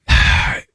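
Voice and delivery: heavy exhaled sigh; big sigh. Retold or imitated. Retelling his reluctant moment to co-hosts, big sigh. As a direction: let out one big sigh. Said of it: "I had that big sigh".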